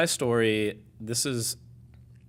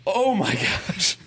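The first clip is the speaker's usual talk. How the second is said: loud, high, slow dismay; hammed-up shocked realisation; retold horror; 8 semitones higher, 7 dB louder, much slower than usual. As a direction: drag it out in comic panic. Retold panic at the accident.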